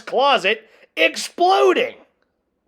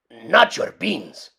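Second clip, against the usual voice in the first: outraged cry; 7 semitones lower, slower than usual. A narrator's reading. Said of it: "cried Skipito, outraged".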